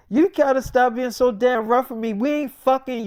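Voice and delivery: acted irritated telling-off; exasperated scolding. Sick wife scolding husband for roughness.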